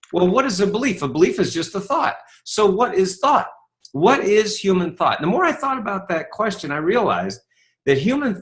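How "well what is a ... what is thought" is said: normal talking voice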